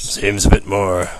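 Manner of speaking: low, slow role-play voice. Acted. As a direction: slip into a low, drawn-out game-master voice. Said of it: "I put on my role playing voice".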